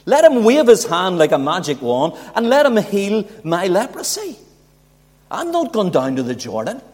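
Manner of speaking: high, loud, huffy protest; preacher voicing Naaman's scornful refusal, high and loud; indignant Naaman. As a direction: act out Naaman's offended pride. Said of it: "Naaman is going".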